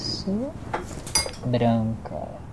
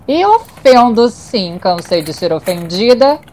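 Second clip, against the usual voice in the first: defiant; 7 semitones higher than usual. Acted. Defiant raised retort.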